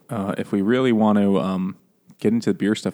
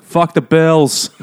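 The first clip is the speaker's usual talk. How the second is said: slow, higher, louder mock outburst; mock shout; 7 semitones higher, 8 dB louder, much slower than usual. Retelling the hunter, a slow mock shout about buffalo. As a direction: bellow it out, hammed up.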